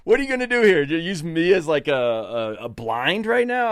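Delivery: high, laughing incredulous question; amused high outrage; laughing disbelief. Retold or imitated. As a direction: poke fun at the absurd plan. Mock outrage at buddy's plan.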